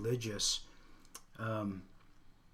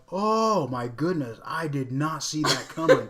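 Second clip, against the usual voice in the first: mock shocked daughter; 3 semitones higher, 7 dB louder than usual. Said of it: "and she just like".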